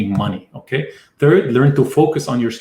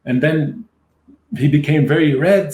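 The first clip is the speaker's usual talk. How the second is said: ordinary talk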